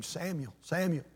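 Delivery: a hushed, distant calling of the name; soft divine call. Acted. God's voice calling softly at night.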